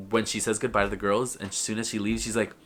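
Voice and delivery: ordinary talk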